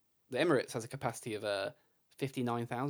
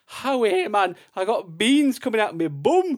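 high, loud hammed-up Geordie; mock Geordie sting voice; 9 semitones higher, 11 dB louder than usual. Host doing a silly Geordie sting to open the quiz, loud and hammed-up. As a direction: belt it out like a silly Geordie jingle. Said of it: "some sort of sting here".

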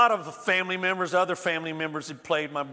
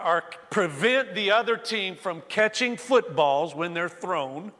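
ordinary talk, nothing marked

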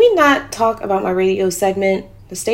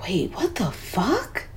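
softer, slow, whispered disbelief; stunned whisper; 7 dB quieter, slower than usual. Acted. Woman seeing her viral Instagram, stunned whisper. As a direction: breathe it out in shock.

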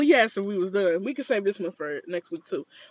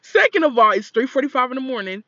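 firm telling-off; 4 semitones higher, 6 dB louder than usual. Retold or imitated. Telling off drunk guest.

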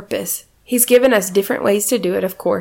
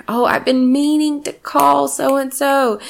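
remembering aloud; 6 semitones higher, slower than usual. Retold or imitated. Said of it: "maybe you remember".

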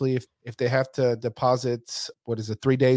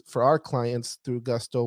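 usual speaking voice

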